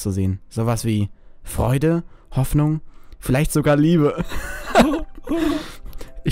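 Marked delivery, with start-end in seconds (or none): 4.3-6.2 squeaky laugh, higher; high giggle. Real, not acted. High giggle at the love line. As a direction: break into a giggle.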